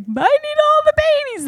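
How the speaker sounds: very high wailing cry, retold; crying plea. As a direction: wail it through tears. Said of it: "just crying and crying and crying".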